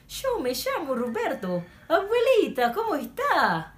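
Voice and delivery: very high, swooping Italian-like Argentinian Spanish; Argentinian accent. Shown as a demonstration. Teacher demonstrating with a greeting to grandmother, sing-song Argentinian accent. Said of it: "ganito na po yun".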